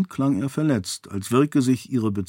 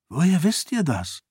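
high, wary question; mistrustful question, raised in pitch; suspicious; 7 semitones higher than usual. A narrator's reading. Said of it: "fragte Sarene misstrauisch".

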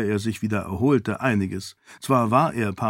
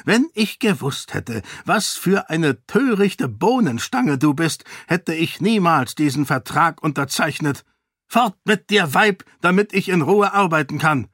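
higher and rising to a sharp dismissal; contemptuous king; 6 semitones higher than usual. Acted. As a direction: sneer at the fool, then send her off. King insults Sarene. (verächtlicher König, abweisend)

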